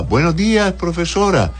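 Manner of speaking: schoolchildren's greeting. Retold or imitated. Pupils standing to greet their teacher, sing-song and high. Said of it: "uno se ponía de pie y uno saludaba".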